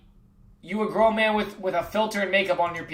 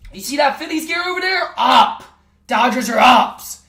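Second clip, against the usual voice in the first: much higher, louder hype; excited shout; 5 semitones higher, 5 dB louder than usual. Real, not acted. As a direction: yell it across the room.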